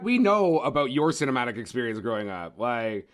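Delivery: ordinary delivery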